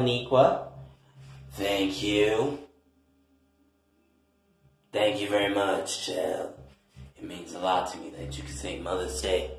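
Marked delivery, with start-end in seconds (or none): 1.5-9.5 low, soft grandmother voice; grandmother, grateful. Acted. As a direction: thank the child quietly in a low voice. Plays Grandma Niqua thanking the child.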